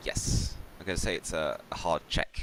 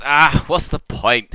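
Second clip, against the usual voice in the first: defeated sigh; 10 semitones higher, 13 dB louder, slower than usual. Acted. GM voices defeated character.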